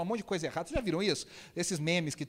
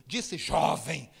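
imitated preacher cry, high, loud and drawn out; mock pulpit proclamation; preacher's intonation; 9 semitones higher, 6 dB louder, much slower than usual. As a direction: stretch it slowly like a fervent radio preacher. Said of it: "ele faz uma entonação de pregador".

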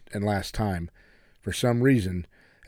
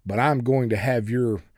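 usual speaking voice

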